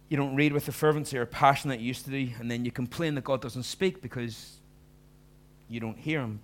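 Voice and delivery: loud, driving sermon delivery; fervent preaching. Acted. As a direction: preach it hard to the congregation. Preaching at full force.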